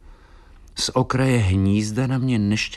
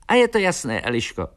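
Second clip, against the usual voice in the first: decided swallow; 9 semitones higher, faster than usual. A narrator's reading. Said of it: "řekl Filip".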